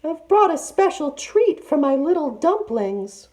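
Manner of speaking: very high, sugary falsetto; fake auntie voice. A narrator's reading. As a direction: lure the girls with a treat. Disguised tiger voice.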